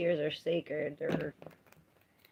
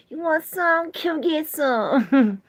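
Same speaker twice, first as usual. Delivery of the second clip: teasing; 10 semitones higher, 10 dB louder, slower than usual. Real, not acted. Teasing offer of free readings.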